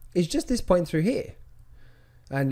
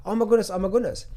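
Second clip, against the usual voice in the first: quick, higher-pitched mock fretting; mock freaking out; 5 semitones higher, faster than usual. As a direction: rush it like a mildly panicking trader. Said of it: "they're freaking out because they're like".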